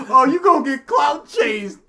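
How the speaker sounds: usual speaking voice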